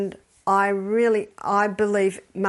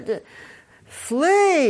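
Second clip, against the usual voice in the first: loud, high, stretched cry; urgent command; 6 semitones higher, 6 dB louder than usual. Acted. Adviser to someone about to lose it, loud urgent command. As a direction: proclaim it like an alarm.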